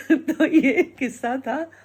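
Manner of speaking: storyteller laughing as she closes the tale. Real, not acted. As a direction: laugh through the wrap-up. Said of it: "हंस हंस के पागल हो जाती हूँ".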